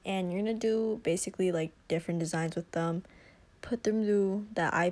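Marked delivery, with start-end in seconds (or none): none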